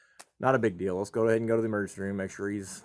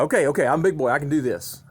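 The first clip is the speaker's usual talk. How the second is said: put-on louder, higher kid voice; proud little boy; 4 semitones higher, 6 dB louder than usual. Little son reassuring his dad at the DVD box. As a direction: puff up like a big boy. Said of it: "And so he's like".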